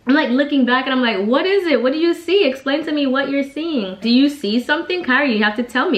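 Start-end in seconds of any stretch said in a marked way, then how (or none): 1.2-6.0 raised pitch, insistent questions; urgent questioning. Retold or imitated. Mother presses her frightened daughter to explain, urgent high-pitched questioning. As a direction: press a child for an answer.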